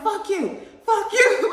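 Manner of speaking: high, laughing fuck-you yell; retold angry yell. Yelling at the man outside, high and laughing. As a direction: spit it at him, half laughing. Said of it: "And I was like".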